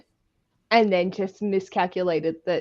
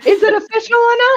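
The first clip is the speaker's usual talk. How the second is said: mock exasperated question; 12 dB louder than usual. Joking about repeated weddings.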